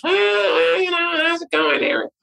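high nasal John Shaw impression; nasally voice. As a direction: squeeze it through the nose, nasal. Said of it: "John had that real nasally voice".

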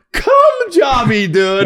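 high laughing yell through giggles; real laughter breaking into a shout. Real, not acted. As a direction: crack up while yelling it. Laughing at the joke.

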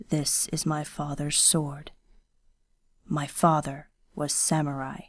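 a proud son's quiet, fierce defence of his father; quiet, fierce and steady. Acted. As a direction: say it quietly with steel underneath. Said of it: "Kenishi's words were quiet, fierce, and steady".

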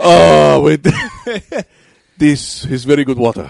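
loud manic delight; crazy voice. Acted. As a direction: savor the water like a madman. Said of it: "give him a crazy voice".

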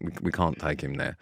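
low, soft word on the side; confidential aside. Retold or imitated. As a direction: imitate a discreet refusal to a mate, kept down. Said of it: "pulled me to the side".

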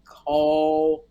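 low, slow, solemn divine command; a lightly voiced heavenly voice handing down an order; voice of God. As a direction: boom it out like God calling down from above. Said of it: "And God said".

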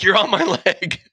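high pained yelp with a laugh; mock scream. Chris yelping as Brian sits on his shot leg, mock high yelp. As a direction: playfully re-enact the yelp. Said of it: "Chris screams".

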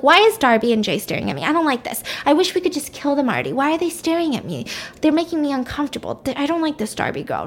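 high, quick whiny fretting; getting really upset. Retold or imitated. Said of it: "he's getting really upset".

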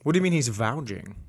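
ordinary delivery

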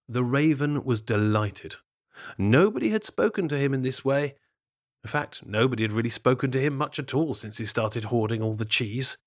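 plain reading voice

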